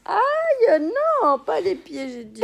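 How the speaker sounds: high, loud laughing squeal; being tickled. Real, not acted.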